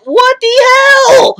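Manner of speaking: a very high squeal of disbelief; shocked outburst. Retold or imitated. Shinichi squeezing opai, squealing high in disbelief. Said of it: "is just like".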